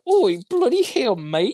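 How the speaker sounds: high, loud British yob; mock British accent. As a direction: squawk it like a cheeky bloke. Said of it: "is she like".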